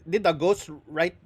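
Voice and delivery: ordinary delivery, nothing marked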